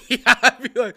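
loud, high, laughing through the words; cracking up mid-sentence. Real, not acted. Laughing at the joke.

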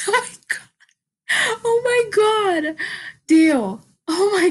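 high laughing, slow and squeaky; laughing relief. Real, not acted. Vlogger laughing at remembering the word, high and slow. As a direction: crack up at finally finding the word.

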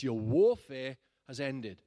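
"warfare" stretched and loud, slow; proclaimed slowly. Retold or imitated. Preacher retelling the prophetic word to his church. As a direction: declare each word with weight.

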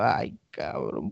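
low, soft, very slow groan of dismay; deflated mock despair, low and slow; sinking dread. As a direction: sag as the disaster lands. Realising the two are best friends.

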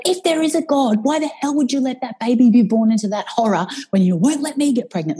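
high-pitched, bitter demand, half-crying; a hint of a furious, tearful prayer; retold rage at God. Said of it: "the rage was real".

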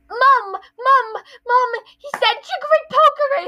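put-on high, loud whiny child calling for mom; whiny tattling kid. Dudley running from Harry, calling mom in a high loud whine.

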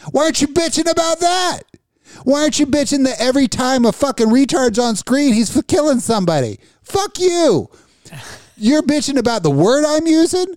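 high-pitched, worked-up comic tirade; ranting outburst. Acted. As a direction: rant indignantly, pitch shooting up. Comic rant at people taking offense.

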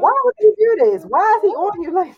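high, loud whiny complaint; outraged toddler voice. Retold or imitated. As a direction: whine it as the jealous son. Mock outrage voicing son.

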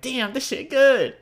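high, slow, loud "damn this shit good"; drawn-out delighted moan; savoring food. Pretend. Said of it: "you're gonna be like".

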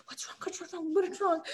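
quick hushed then rising what's wrong; worried coach. Retold or imitated. Worried coach asking his crying kicker what's wrong, hushed then rising.

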